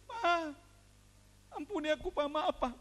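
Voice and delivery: mock crying child's plea; imitated tearful pleading. Son begs his father for forgiveness, imitated crying. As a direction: beg forgiveness in a pretend wail. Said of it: "Udah nangis".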